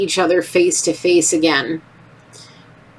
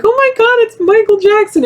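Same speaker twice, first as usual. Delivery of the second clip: much higher, louder fangirl shriek; starstruck squeal; 4 dB louder than usual. Retold or imitated. Little girl shrieking at seeing [name]. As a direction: squeal like a kid spotting her idol. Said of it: "I'm standing on my chairs freaking out like".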